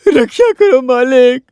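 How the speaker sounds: hammed-up pleading. Debtor begs his master for protection, slow theatrical pleading. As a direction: beg for rescue with drawn-out words.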